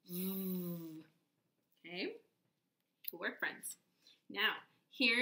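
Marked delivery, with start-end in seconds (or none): 0.1-1.0 a long buzzed vvv with lips on teeth; buzzing V sound. Shown as a demonstration. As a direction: let the lower lip tickle on the V. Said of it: "You're gonna go".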